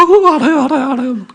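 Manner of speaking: imitating eager daughter. Daughter to father, high and eager. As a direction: answer quickly like a guilty kid.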